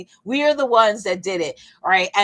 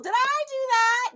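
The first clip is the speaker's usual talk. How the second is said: very high, squeaky catchphrase voice; exaggerated high-pitched impression; Urkel. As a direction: put on a high comic nerd voice. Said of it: "You sound like Urkel".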